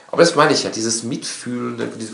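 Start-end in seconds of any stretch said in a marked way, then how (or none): none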